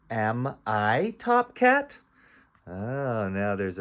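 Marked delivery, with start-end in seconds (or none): 0.1-2.0 "Am I top cat" word by word, rising at the end; drawn-out, questioning read-aloud; slow question reading. Shown as a demonstration. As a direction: read it slowly as a question for a beginner. Teacher reads decoded question slowly.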